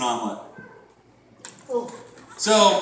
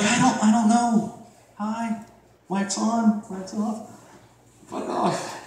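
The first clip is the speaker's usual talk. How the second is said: mock awkward; 5 semitones higher, slower than usual. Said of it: "I'm like".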